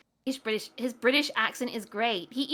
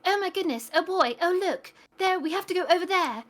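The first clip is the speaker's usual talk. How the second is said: higher, hammed-up British game voice; exaggerated British accent; 5 semitones higher than usual. Mocking how British actors are directed in games, exaggerated British accent. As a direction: overdo the British accent with no urgency. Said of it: "force them to sound even more British".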